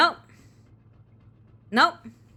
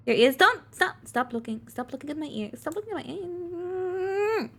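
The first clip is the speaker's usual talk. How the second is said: whiny pleading character; 8 semitones higher than usual. Acted. Character begging viewers not to look, higher and fading. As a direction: plead like you've been caught undressed.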